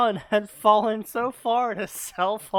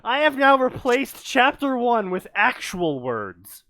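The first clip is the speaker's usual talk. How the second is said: mocking pompous voice; 6 semitones higher, 7 dB louder than usual. Mocking a co-host's announcement.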